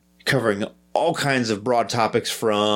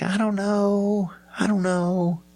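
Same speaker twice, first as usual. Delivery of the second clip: high, drawn-out waffling; hammed-up doubt; 10 semitones higher, slower than usual. Host torn over his own argument, hammed-up doubt. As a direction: stretch it out high, acting torn.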